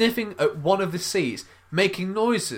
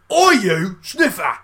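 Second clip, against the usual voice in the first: loud, drawn-out mock shout; retold yell; 3 semitones higher, 8 dB louder, slower than usual. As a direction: yell it out as if catching someone. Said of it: "Uh, I yelled".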